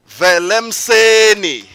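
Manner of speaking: loud, high, drawn-out name announcement; big slow artist introduction; calling out an act. Real, not acted. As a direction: stretch the name out for the crowd. Host calls out artist's name.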